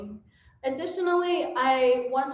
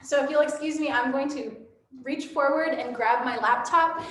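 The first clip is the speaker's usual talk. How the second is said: ordinary talk, nothing marked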